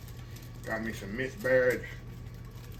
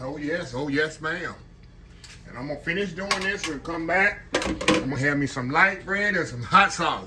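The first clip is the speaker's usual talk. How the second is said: ordinary talk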